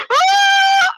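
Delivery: piercingly high, loud mock shriek; falling scream. Hero falling from the sky, mock scream. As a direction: shriek all the way down.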